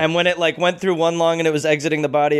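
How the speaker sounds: normal talking voice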